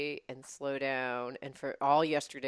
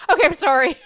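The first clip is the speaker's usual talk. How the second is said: high, loud, fed-up apology; frustrated apology; 11 semitones higher, 16 dB louder, faster than usual. Retold or imitated. Telling friend about the bad call, loud fed-up sorry. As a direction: apologise while at your wits' end.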